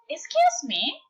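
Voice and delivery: high, small polite voice; a tiny creature asking for attention; polite cricket. Acted. Cricket addresses the lonely giraffe, small high polite voice. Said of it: "coughed the cricket".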